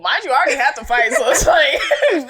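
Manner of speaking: loud and high, laughing through it; real laughter breaking into the words; laughing protest. Real, not acted. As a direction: protest while cracking up. Laughing at sister's pointless coaching.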